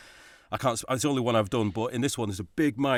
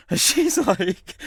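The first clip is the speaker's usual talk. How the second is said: laughing; 9 semitones higher, 6 dB louder, much slower than usual. Real, not acted.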